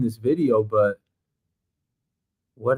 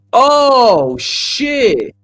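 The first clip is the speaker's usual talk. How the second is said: shocked exclamation; 12 semitones higher, 8 dB louder than usual. Real, not acted. Reacts to shocking clip.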